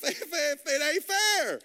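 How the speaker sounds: drawn-out, loud, laughing exclamation; gleeful crowing. Real, not acted. Preacher celebrating his upgrade to the congregation, gleeful crowing. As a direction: crow it high and stretch it out.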